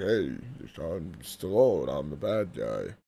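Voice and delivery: low, slurred, mumbling Stallone voice; Stallone impression. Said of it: "Stallone's like".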